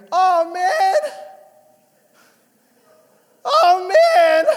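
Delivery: put-on high, loud child wailing; six-year-old son yelling from upstairs in frustration; son's frustrated cry. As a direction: whine it out like a stuck kid upstairs. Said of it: "and I hear".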